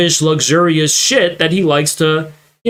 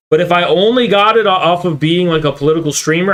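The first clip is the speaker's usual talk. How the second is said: usual speaking voice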